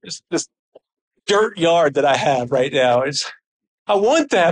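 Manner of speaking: ordinary delivery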